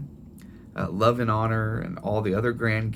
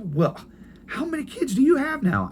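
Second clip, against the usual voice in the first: put-on much higher, curious old mother; grandma's voice; 4 dB louder than usual. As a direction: squeak up high like a puzzled grandmother. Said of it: "I said".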